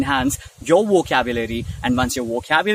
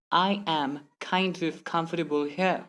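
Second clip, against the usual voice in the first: slow, "I am kind of" in separate words; 7 dB quieter, slower than usual. Shown as a demonstration. Teacher repeating the slow example, word-by-word. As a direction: keep every word apart. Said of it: "Instead of saying".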